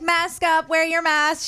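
lightly voiced nagging reminder; co-host imitating their on-air mask reminders; imitating the radio hosts. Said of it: "she hears us always saying".

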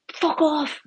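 high mock yell at Steve; imitating the bus driver. Bus driver telling Steve to go away, high mock yell. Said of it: "the bus driver's like".

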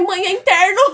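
high, loud line breaking into laughter; can't keep a straight face on the excuse; laughing through it. Retold or imitated. Telling mom the pain is internal, cracking up laughing.

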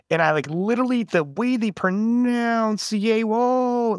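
"pronounce" and "whoa" drawn out long on a high, held pitch; stretched-out words. Shown as a demonstration. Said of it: "stretch out some words".

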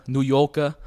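mock Brooklyn accent, punched out. Shown as a demonstration. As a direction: drop the r's in a thick New York accent. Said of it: "Very, very stereotypical New Yorker".

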